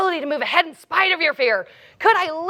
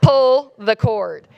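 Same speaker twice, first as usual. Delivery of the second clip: low, slow deadpan order; kid on the PA; 5 semitones lower, 4 dB louder, much slower than usual. Retold or imitated. Ride kid repeating the order to a frozen rider, slow deadpan. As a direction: spell it out slowly as an unimpressed announcer. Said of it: "comes back on the P A".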